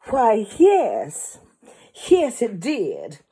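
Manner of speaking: a playful stab at a Southern belle; southern accent. Acted. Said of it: "I even attempted a southern accent there".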